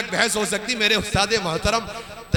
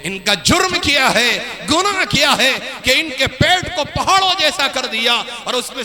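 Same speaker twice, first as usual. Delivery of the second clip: raised sermon delivery with drawn-out key words; fervent preaching; 4 semitones higher than usual. Acted. Preacher naming the wealthy sinners, fervent preaching. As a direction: proclaim it with force, stretching the weighty words.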